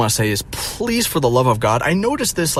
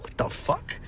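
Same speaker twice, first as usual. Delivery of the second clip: incredulous whisper; 12 dB quieter than usual. Retold or imitated. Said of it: "I'm like".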